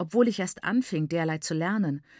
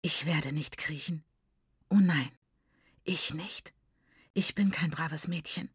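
hushed, tense refusal; soft, strained defiance; 3 semitones lower, 4 dB quieter than usual. A narrator's reading. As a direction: refuse under your breath, holding it in. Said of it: "Ihr Körper bebte vor Anspannung".

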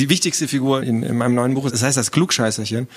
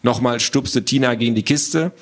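usual speaking voice

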